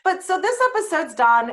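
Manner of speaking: laughing through the words; laughing wrap-up. Real, not acted. Host laughing as she ends the episode. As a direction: laugh it out, relieved.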